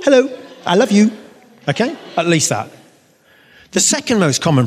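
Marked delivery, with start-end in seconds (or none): 0.0-1.1 a tender divine greeting; voice of God. Pretend. God greeting his people, high and loving.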